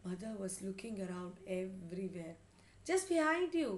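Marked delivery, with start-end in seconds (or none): 2.9-3.7 high, loud urgent warning; shouted. A narrator's reading. Father warns mother about the mouse, shouted. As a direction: shout a warning across the room. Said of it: "shouted father again".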